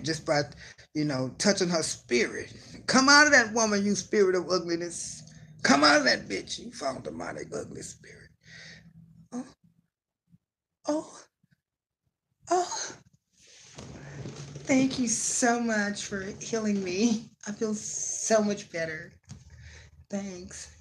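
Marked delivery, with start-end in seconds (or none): 2.9-8.3 loud, high mock exorcism; mock preacher casting out. Host playing a preacher casting out an ugly spirit. 9.3-20.8 high, slow grateful voice; mock a tearful thank-you after healing; healed woman. Host playing the healed woman thanking the preacher.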